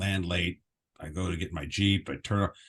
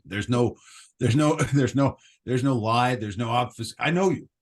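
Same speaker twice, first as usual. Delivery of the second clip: laughing; 5 semitones higher, 6 dB louder than usual. Real, not acted. Laughing teasing friend.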